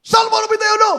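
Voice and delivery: shouted. Retold or imitated. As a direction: cry it out to the men. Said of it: "Sabi ko".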